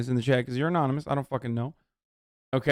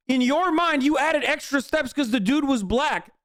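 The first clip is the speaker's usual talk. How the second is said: raised, pitched-up angry rant at chat; heated rant; 7 semitones higher than usual. Real, not acted. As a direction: tear into the chatter, voice climbing high.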